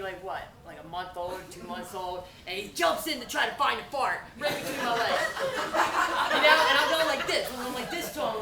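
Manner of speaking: ordinary talk, nothing marked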